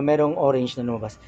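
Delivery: ordinary delivery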